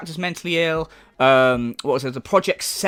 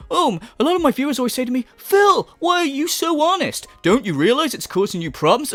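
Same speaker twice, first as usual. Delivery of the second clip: higher, exaggerated earnest impression; mocking imitation; 6 semitones higher than usual. Commentator imitates him quoting adoring viewers, mocking. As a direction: send him up, swooping high on the flattery. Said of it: "when he says shit like".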